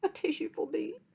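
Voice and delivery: small high pleading voice; pleading flea voice. A narrator's reading. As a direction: beg for a tissue in a tiny voice. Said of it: "No one heard him garble plea".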